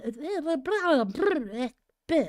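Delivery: high garbled blah-blah after a stumble; tongue-tied blabber. Real, not acted. Reader fumbling her line, mock gibberish blabber. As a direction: shake out the tongue with nonsense noises.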